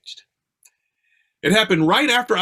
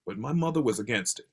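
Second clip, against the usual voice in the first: ordinary delivery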